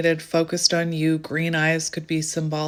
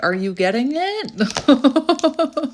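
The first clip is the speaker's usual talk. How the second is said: higher, very slow teasing voice; the universe speaking; 8 semitones higher, 4 dB louder, much slower than usual. Acted. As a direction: drawl it out like a knowing cosmos. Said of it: "the universe is like".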